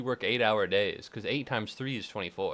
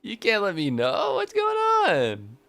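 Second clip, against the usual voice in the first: laughing disbelief; 6 semitones higher, 4 dB louder, slower than usual. Real, not acted. Laughing disbelief at dad's text.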